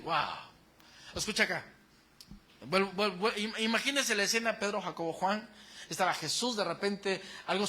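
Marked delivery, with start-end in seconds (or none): none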